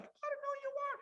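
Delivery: put-on very high, baby-like bewildered voice; confused baby. Newborn baby puzzled by celebrating relatives, high voice. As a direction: play a newborn staring at strangers. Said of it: "we're sitting there like".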